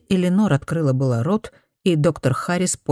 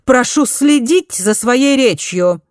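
higher, louder, slow scolding; sharp reproach; 8 semitones higher, 5 dB louder, slower than usual. A narrator's reading. Mother scolding the doctor for indecent words, slow and loud. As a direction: cut him off with prim outrage. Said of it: "резко одёрнула его".

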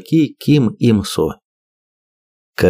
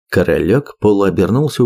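usual speaking voice

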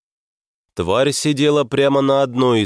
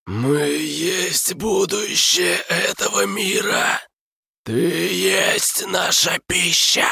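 eldritch horror; 9 semitones higher, slower than usual. Acted. Monster threatens hero.

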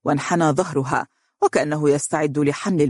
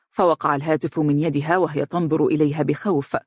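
plain reading voice

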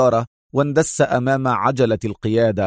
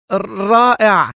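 رائع stretched slow, high and louder; drawn-out delight; 9 semitones higher, 4 dB louder, much slower than usual. A narrator's reading. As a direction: savor the word رائع slowly. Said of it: "وانتقل الارتعاد إلى صوته".

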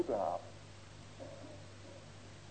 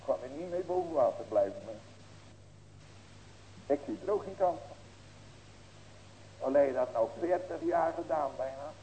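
normal talking voice

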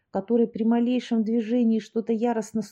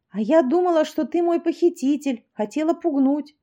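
excited; 7 semitones higher, 4 dB louder than usual. Acted. Tanya explains excitedly.

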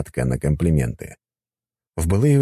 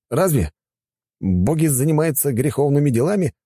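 put-on lightly higher, courteous young man; polite young man voice; 9 semitones higher than usual. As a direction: speak as a respectful young god. Gauss backs away. (вежливый молодой мужчина)

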